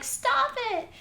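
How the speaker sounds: very high, imitated child's scream; screaming and crying. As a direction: plead like a panicked girl, without real sobs. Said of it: "she's screaming and crying".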